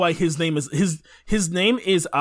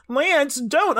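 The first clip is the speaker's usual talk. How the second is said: higher, mock-pleading plea; pleading girl; 10 semitones higher than usual. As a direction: beg him in a higher voice. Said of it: "she's like".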